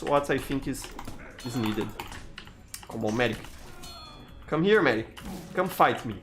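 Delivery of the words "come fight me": ordinary talk, nothing marked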